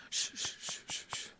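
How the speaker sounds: very soft, unvoiced shushes; father's hushing. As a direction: imitate a father lulling his child back to sleep. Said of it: "escuchar a mi papá que". (siseo muy suave de papá arrullando)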